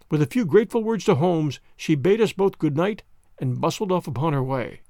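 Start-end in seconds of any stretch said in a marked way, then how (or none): none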